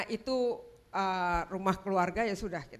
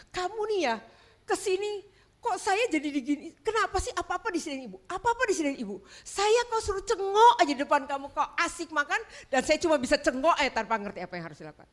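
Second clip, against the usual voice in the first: retold anger; 7 semitones higher, 4 dB louder than usual. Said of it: "saya marah".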